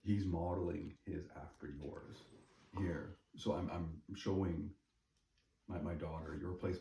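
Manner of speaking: ordinary delivery, nothing marked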